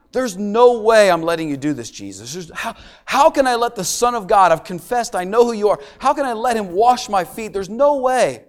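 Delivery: playing Peter, loud and adamant; loud insistent refusal. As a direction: refuse him flatly, voice climbing. Pastor voices Peter refusing Jesus.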